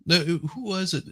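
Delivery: normal talking voice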